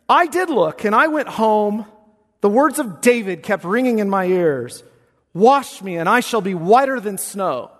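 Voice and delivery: loud, high Baptist preaching; preacher retelling a conversion at full force. Acted.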